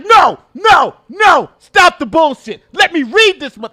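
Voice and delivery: high, slow, shouted impression; mock shouted interviewer. Streamer voicing the interviewer calling out lies, shouting. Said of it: "She's like".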